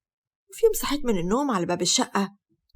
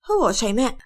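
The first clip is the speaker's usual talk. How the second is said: plain reading voice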